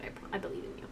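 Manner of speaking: very soft, voiceless whisper; whispered encouragement. Real, not acted. Encouraging Jill to survive another route, whispering. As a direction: whisper it supportively.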